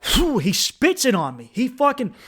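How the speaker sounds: normal talking voice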